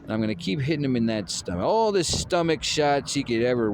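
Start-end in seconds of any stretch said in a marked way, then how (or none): none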